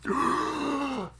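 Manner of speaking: sudden high-pitched gasp; reviving gasp. Acted. GM voicing a healed man reviving, sharp gasp. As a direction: gasp sharply back to life.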